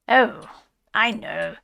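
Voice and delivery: loud, hasty evasive brush-off; dodging, loud and hurried. A narrator's reading. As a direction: wave the question away too quickly. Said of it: "replied the Rat evasively".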